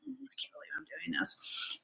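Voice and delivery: hushed, barely-there murmur; disbelieving whisper. Retold or imitated. Recalling nerves during safety lessons, disbelieving whisper.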